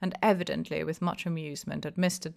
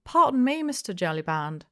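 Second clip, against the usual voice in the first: put-on high, thin old-man objection; feeble protest; 3 semitones higher, 6 dB louder than usual. A narrator's reading. Hempseed objecting to the innkeeper, feeble and high. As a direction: protest weakly, half afraid. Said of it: "feebly protested".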